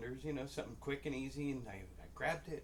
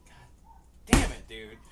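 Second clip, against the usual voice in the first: exasperated cry; 13 dB louder, slower than usual. Retold or imitated. He reacts to the shirtless coworker, exasperated cry. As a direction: let it burst out, then drop on the last word.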